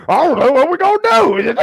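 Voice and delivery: high, upset puppet voice; Grover. Pretend. Host as upset Grover, high puppet voice. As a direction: fret loudly up high like a puppet. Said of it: "you brought Grover into the mix".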